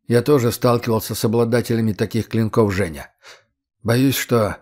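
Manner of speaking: usual speaking voice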